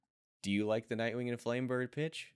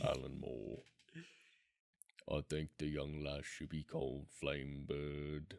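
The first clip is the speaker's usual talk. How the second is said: lower, softer British Alan Moore with more gravel; gravelly Alan Moore; 6 semitones lower, 9 dB quieter than usual. Pretend. Host retrying Alan Moore with more gravel, low British accent. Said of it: "He needs a little more gravel".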